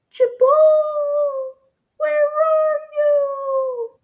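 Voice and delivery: high, slow, far-off calling voice; faint distant call. A narrator's reading. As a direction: call from far away across the storm. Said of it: "a faint voice called in the distance".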